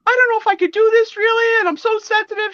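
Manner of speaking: high, loud, fast whimpering complaint; whiny upset. Retold or imitated. Mother on the phone to her daughter, whiny and high. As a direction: whine it like a hurt child. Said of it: "I called my daughter and I said".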